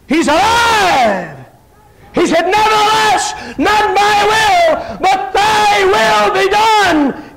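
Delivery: high, loud preaching shout, words held long; bağırarak vaaz. Retold or imitated. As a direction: belt it out slowly at full pulpit force. Retelling his own shouted preaching.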